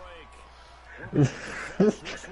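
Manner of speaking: breathy high-pitched laughter. Real, not acted. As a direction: laugh hard, high and airy. Laughing at riddle misunderstanding.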